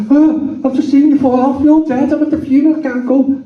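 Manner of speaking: high-pitched mock panic; traumatised. Kevin sees his dead mate walking, high traumatised babble. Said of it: "Totally traumatised by it".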